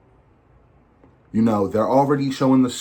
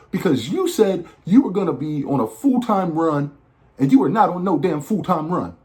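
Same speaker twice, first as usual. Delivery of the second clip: higher-pitched, indignant fan complaint; mock scolding; 7 semitones higher than usual. Fan to John Cena over a broken full-time promise, mock scolding. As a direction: playfully take him to task.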